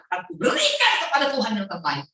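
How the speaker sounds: emphatic vow. Acted. Acting Hana's prayer of offering.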